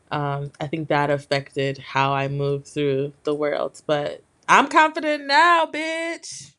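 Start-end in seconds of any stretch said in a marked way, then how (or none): 4.5-6.5 high, sassy declaration. Real, not acted. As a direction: strut it out, full diva. Boastful declaration about confidence.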